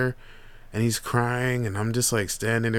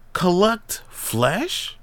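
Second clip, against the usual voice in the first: much higher, slow, rising question; incredulous echo; 7 semitones higher, much slower than usual. Retold or imitated. Uncle repeating nephew's phrase, slow incredulous rise. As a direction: stretch it out in disbelief.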